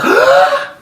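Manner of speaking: huge high gasp of pain; loud startled pained gasp; sharp gasp. Retold or imitated. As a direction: suck in air as the pain hits. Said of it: "I took, like, the biggest breath".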